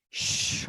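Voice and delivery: long airy "shhh" opening into "sho" on স; স as a thick, drawn-out sh; heavy sh sound. Shown as a demonstration. Teacher answers how the long s sounds, heavy sh. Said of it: "একটু যদি ভারী করার জন্য".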